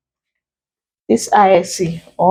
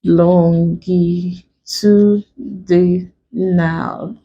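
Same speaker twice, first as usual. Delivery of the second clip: LONGITUDINAL very slow, lon-gi-tu-di-nal, each syllable drawn out; broken into syllables; much slower than usual. Shown as a demonstration. Teacher breaking a word for students, LONGITUDINAL syllable by syllable. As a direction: stretch and separate every syllable of "longitudinal". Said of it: "let's break".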